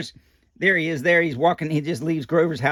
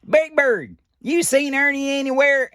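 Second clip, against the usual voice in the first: Bert voice; 10 semitones higher than usual. Acted. Bert asks in read story.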